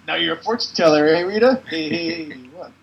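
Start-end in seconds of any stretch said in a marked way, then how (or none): 0.1-1.6 loud fast mocking jab; loud teasing wisecrack; fortune teller taunt. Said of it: "he says".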